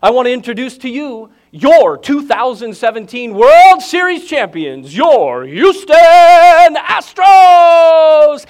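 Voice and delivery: building to a huge, high, drawn-out shout on Houston Astros; hyped PA announcer calling the home team; stadium announcer hype. Retold or imitated. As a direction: stretch the team name out like a ballpark PA. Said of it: "with great anticipation and excitement".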